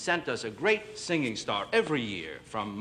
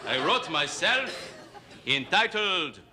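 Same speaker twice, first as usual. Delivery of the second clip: a hint of a hammy French crooner; fake French accent. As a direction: play a fake French singer, lightly. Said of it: "the great fake French singer".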